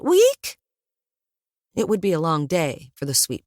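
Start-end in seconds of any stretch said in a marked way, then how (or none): 0.0-0.6 high, loud squeaky week; loud guinea pig squeak. A narrator's reading. Guinea pig answering the narrator with a squeak. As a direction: let out a shrill little rodent squeak. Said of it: "The guinea pig went".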